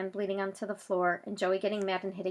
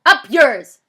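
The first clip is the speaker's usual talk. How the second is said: loud, higher, cheeky yell; defiant shout; 6 semitones higher, 19 dB louder than usual. Acted. Zach shouting rudely at the police voice. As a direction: throw it at the loudspeaker.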